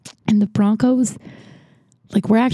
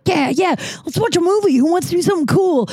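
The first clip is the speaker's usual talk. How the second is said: high, fast, giddy cheer; giddy teacher; 9 semitones higher than usual. Retold or imitated. History teacher to class after a Broncos win, giddy and high. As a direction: play the teacher thrilled by a win.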